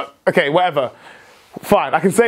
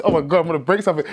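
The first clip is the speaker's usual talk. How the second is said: normal talking voice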